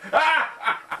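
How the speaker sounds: high-pitched burst of giggling; high giggle. Real, not acted. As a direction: let out a loud tittering laugh. Laughs at own joke.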